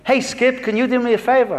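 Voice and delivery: high, loud hail to a stranger, asking a favor; mock-nice request. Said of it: "he really nicely just said".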